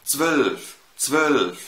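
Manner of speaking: zwölf said with rounded ö; نطق zwölf بالألمانية بشفايف مضمومة; German zwölf. Shown as a demonstration. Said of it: "تعالى نشوف الرقم اتناشر". (نطق zwölf الألماني بالأو أوملاوت)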